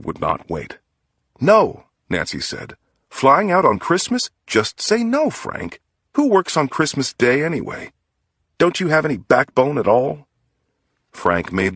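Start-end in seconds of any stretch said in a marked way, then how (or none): 1.4-1.8 a high, loud, outraged no; wife refusing to let her husband fly out, loud protest; sharp protest. Acted. Wife objects to Christmas trip. 3.1-10.3 indignant scolding. Acted. Wife scolding husband over work trip.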